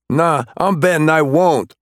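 high, cocky defiance; defiant taunt. Acted. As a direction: throw it back at him. Suspect defying cop.

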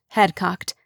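ordinary delivery

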